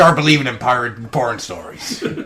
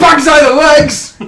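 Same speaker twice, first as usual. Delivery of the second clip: loud outburst; 11 semitones higher, 11 dB louder, slower than usual. Real, not acted. Sudden outcry at the question.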